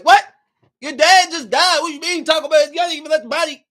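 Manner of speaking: high, loud disbelief; indignant outrage. Real, not acted. Viewer ranting at movie characters, loudly indignant. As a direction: let the outrage burst out.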